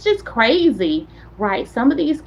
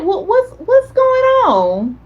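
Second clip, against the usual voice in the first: baffled; 9 semitones higher, slower than usual. Retold or imitated. Baffled reaction to being ignored.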